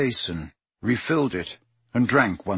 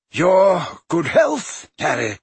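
wry toast; 7 semitones higher, 5 dB louder, slower than usual. Acted. Dumbledore toasts before drinking.

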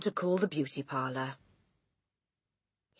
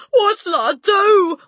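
high, loud, drawn-out wail; despairing wail; 13 dB louder, slower than usual. A narrator's reading. Father begging for advice, despairing wail. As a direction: wail helplessly. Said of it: "wailed the father".

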